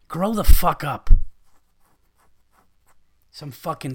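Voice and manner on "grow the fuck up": loud, slow, barked command; angry yell. Real, not acted. Comedian yelling at spammers to grow up, loud and slow. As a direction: shout it at them like a fed-up parent.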